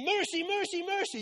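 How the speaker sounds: pinned kid in a headlock, mock pleading for mercy. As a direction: squeal it like a kid losing a wrestle. Wrestler begging in headlock.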